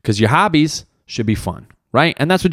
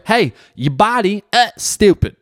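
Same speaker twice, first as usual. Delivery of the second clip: burped punchline; 9 semitones higher than usual. Real, not acted. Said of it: "I burped on that fucking punchline".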